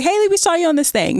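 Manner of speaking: high, fast excited friend voice; mock friend. Girl chat group telling Haley they saw her, high and fast. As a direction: rattle it off like a gossiping friend.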